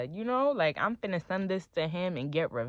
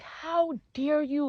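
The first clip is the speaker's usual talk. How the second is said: retold scream; 9 semitones higher, much slower than usual. Girlfriend trashing boyfriend's room, high retold scream. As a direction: draw out an outraged cry without full volume. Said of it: "and I'm screaming".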